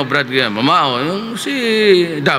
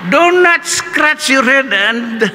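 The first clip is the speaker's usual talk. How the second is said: high, slow professor voice, laughing; imitated professor insult; 9 semitones higher, slower than usual. Professor mocking a student's dandruff, high and slow. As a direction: deliver the dandruff insult like the old teacher. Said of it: "sabi niya".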